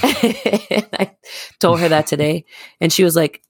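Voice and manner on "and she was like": usual speaking voice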